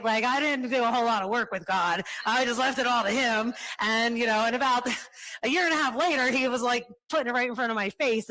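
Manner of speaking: rushing, amused excitement building up; excited. Real, not acted. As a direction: tell it like you can't believe it yourself. Excited storytelling, laughing.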